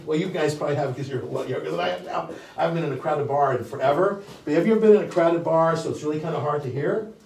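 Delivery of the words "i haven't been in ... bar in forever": normal talking voice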